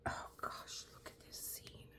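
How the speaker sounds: whispered almost silent; awed whisper. Real, not acted. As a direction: hush it, stunned by the picture. Marveling at the canvas scene.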